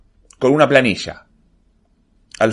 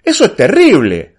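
higher, louder appalled reaction; dismayed protest; 8 semitones higher, 5 dB louder than usual. A narrator's reading. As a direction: burst out in dismay. Protests his assigned class.

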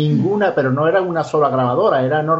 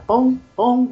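high-pitched "pon", like drops on stone; imitated water drip; 7 semitones higher than usual. Witness demonstrating the drip on stone, high imitated plinks. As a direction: mimic single drops hitting stone. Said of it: "imagínate una gota que cae sobre una".

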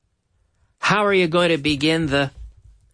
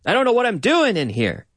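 pleading guest; 6 semitones higher than usual. Retold or imitated. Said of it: "and they say".

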